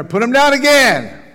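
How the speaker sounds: high, loud call from the crowd; crowd shouting. Retold or imitated. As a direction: shout it out from the riverbank. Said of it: "some of the people around there".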